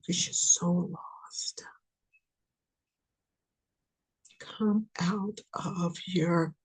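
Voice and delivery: slow whisper. Acted. Reader whispers to the lost viewer, slow. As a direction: hush it out slowly.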